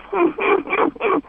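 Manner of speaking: high, soft tittering; mimicked giggle. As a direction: act out a little high giggle after crying. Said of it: "I stopped crying and went".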